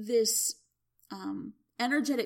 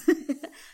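a short real laugh, louder and higher; 6 semitones higher, 7 dB louder than usual. Real, not acted. Healer laughing at her own point, short real laugh.

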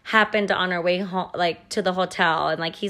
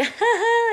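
bright high put-on giggle to look happy; fake laugh; 4 dB louder than usual. Shown as a demonstration. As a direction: laugh it off for show, high and quick. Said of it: "like, laughing".

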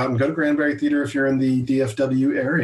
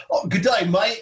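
higher, mock Australian greeting; Australian accent; 6 semitones higher than usual. Player of a card game attempting Australian accent. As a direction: try a light, badly faked Australian accent. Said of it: "you get an Australian accent".

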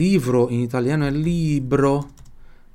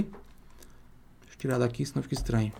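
quiet aside; 9 dB quieter than usual. Real, not acted. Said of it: "muttered aside".

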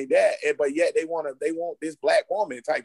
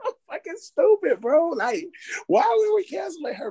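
high, laughing voice; after laughing hard; 12 semitones higher than usual. Real, not acted. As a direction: talk through leftover laughter.

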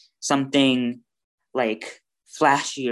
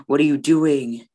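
louder, scandalised onlooker; mock shock; 4 dB louder than usual. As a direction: act appalled at bad table manners. Voicing shocked onlookers at school.

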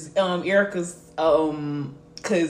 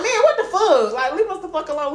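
high, loud mock complaint; retold exasperated protest; 9 semitones higher, 4 dB louder than usual. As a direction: push the pitch up in indignant protest. Retells protest to jail staff.

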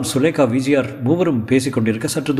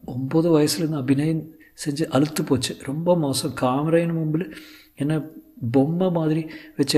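high, soft, weary actress; Sulekha; 4 semitones higher, 4 dB quieter than usual. Acted. Actress tired of cinema confiding in tutor, high subdued voice. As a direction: confide it softly in a raised voice. Sulekha pours out her weariness.

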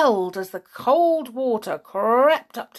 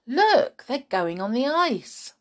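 startled, high-pitched alert; sudden exclamation; 6 semitones higher than usual. A narrator's reading. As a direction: point it out urgently to a friend. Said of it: "said Tom suddenly".

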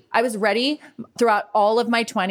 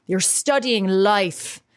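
imitating her dad's Irish accent, slow and tender; slow, loving Irish brogue; slower than usual. Irish father encouraging discouraged daughter, slow Irish accent. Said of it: "my Irish accent isn't as good".

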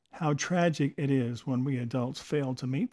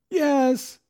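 high, louder mock sob of a small boy; sobbing child; 12 semitones higher, 6 dB louder than usual. Tearful boy in the restroom, answering the adult with a high sob. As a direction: squeak it out like a tearful little kid. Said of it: "he sobbed".

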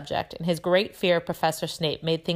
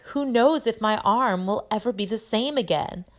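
higher, swooping mock woe; fake sighing; 6 semitones higher, 3 dB louder than usual. A narrator's reading. Malfoy lamenting his injured arm, with fake sorrow. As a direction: put on self-pity. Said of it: "he gave a huge fake sigh".

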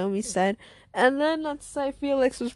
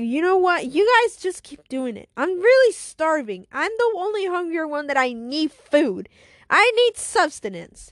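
loud, higher-pitched hungry whine; pleading, raised-voice grumble; complaining; 4 semitones higher, 5 dB louder than usual. Acted. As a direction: complain insistently about starving. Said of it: "after Annika's complaining".

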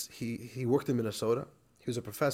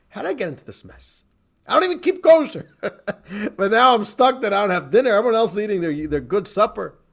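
loud, high, exasperated grumbling; hammed-up indignation at being stuck; upset complaint; 7 semitones higher, 10 dB louder than usual. Professor stuck without dinner on the plane, very upset. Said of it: "He's very, very upset".

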